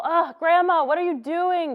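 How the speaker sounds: loud, high, grossed-out whine; disgusted grandson. Pretend. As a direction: recoil at grandma's kiss, shrieking. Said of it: "like a total".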